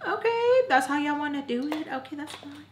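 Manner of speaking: high, sing-song sarcastic okay. Retold or imitated. Narrator mocking her friends' rejection, high and sarcastic.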